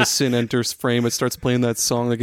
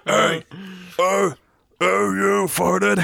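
higher, slow, hammed-up monster voice; Cookie Monster voice; 7 semitones higher, much slower than usual. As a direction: belt it slowly like a cartoon monster. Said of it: "but, like, in a Cookie Monster voice".

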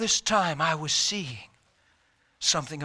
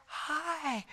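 tiny, high, soft hi; imitating a weak whisper after a trach; imitated trach whisper; 13 semitones higher, 8 dB quieter than usual. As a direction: barely get it out, high and faint. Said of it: "had a whisper 'cause of the trach".

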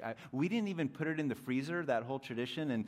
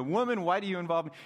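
quick brush-off; 4 semitones higher, 6 dB louder, faster than usual. Pretend. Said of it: "maybe he didn't say it that way exactly".

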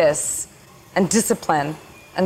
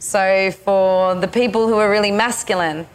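normal talking voice

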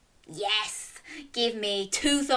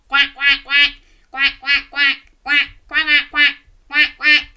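loud, higher-pitched rapid quacks; duck quacks; 5 semitones higher, 9 dB louder than usual. Shown as a demonstration. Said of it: "Can you quack like a duck?".

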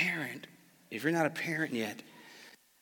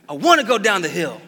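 put-on loud, higher defiant child; defiant kid; 4 semitones higher, 10 dB louder than usual. Father imitating his son insisting on the hill, loud whine. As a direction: demand it like a stubborn four-year-old. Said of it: "he does, it's like".

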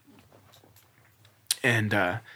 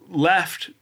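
usual speaking voice

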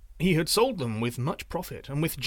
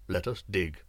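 low looming threat; 6 semitones lower than usual. A narrator's reading. Sorcerer voiced in story.

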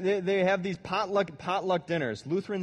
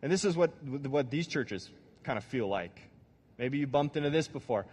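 normal talking voice

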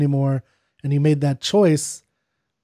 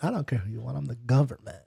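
mock Southern government; 3 semitones lower, 10 dB quieter, faster than usual. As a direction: lightly play a Southern official claiming power. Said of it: "that, like, backwater Southern".

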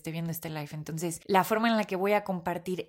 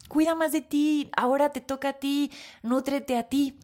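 mother's advice; 6 semitones higher than usual. Retold or imitated. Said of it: "mi mamá me decía estas palabras".

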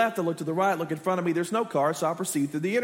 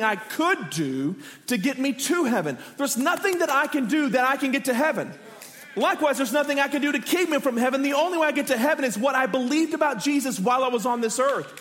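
preaching; 4 semitones higher than usual. Real, not acted. Pastor preaching to the congregation, fervent and raised. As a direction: preach it out with conviction.

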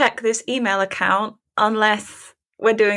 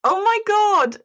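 shocked disbelief; 11 semitones higher than usual. Real, not acted. Shocked at unseen email.

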